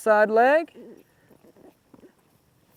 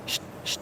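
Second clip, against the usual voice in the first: hushing shh; 14 dB quieter than usual. Real, not acted. Calming the horse mid-run.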